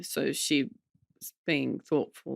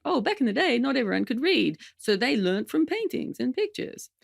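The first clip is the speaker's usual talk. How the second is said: mock know-it-all; 7 semitones higher than usual. Mocking a common myth.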